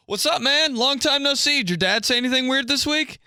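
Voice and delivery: high, mock-excited bro voice; hammed-up bro greeting. Podcaster mocking fans who greet him with a high bro voice. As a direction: greet like an overeager fan. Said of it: "they're like".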